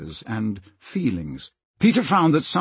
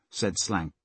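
ordinary delivery, nothing marked

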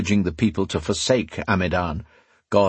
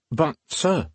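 put-on higher-pitched, startled young servant; 7 semitones higher than usual. A narrator's reading.

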